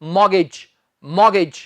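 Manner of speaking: मॉर्गेज said as mor-gej, no t sound; right pronunciation. Shown as a demonstration. Teacher correcting students, says मॉर्गेज without the t. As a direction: say मॉर्गेज with the t dropped. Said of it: "इसका सही उच्चारण है मॉर्गेज".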